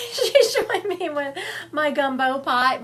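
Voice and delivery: high, loud, laughing through the words. Real, not acted. Amused by the spirit's cooking image.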